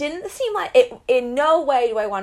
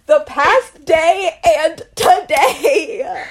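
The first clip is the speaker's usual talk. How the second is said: laughing repeat; 5 semitones higher, 6 dB louder, much slower than usual. Retold or imitated. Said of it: "is like".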